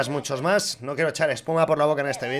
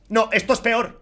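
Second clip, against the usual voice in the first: ordinary talk, nothing marked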